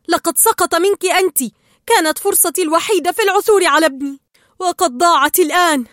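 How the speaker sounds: loud, high, heated outburst; angry shout. A narrator's reading. Marlin blames Dory for losing his only chance, loud upset shout. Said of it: "فصاح مارلين".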